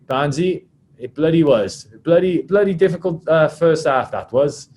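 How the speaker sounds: emphatic mimicked player agreeing; Adam Jones. Welsh prop chatting to the referee about the match, lightly mimicked. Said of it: "British Irish Lions and Welsh prop".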